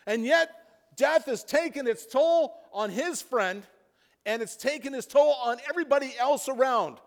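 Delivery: high, loud preaching building to a shout; impassioned preaching. Acted. Preacher crying out about death and despair, full force. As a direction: lift the congregation with rising fervor.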